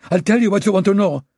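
high, loud, panicked offer; panicked surrender. Acted. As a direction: give in out of panic. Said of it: "the panic rising in his voice".

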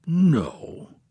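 a prince's self-assured no; confident. A narrator's reading. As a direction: deny it with complete assurance. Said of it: "in his same confident tone".

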